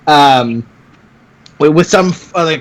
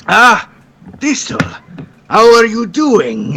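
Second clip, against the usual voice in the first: lightly voiced old smith, higher and slow on the greeting; a hint of a cranky old man grumbling a welcome; mild old-man greeting, dragged out; grumpy old man; 6 semitones higher, slower than usual. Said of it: "he just turns angrily and goes".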